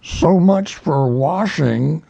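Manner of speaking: dry remark. A narrator's reading. Old donkey comments on the splash, slow and sour. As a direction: sneer at the fuss. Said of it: "said Eeyore".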